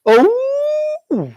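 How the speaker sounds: sharp gasp, then long high-pitched "oh"; exaggerated pearl-clutching "ooh"; mock shocked gasp. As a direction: imitate scandalised onlookers with drawn-out, high oohs. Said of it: "the face of the ones when he got and walked out, they went".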